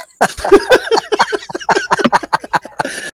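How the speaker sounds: very high cartoon laugh; squeaky laugh. Real, not acted. After Mickey Mouse bit.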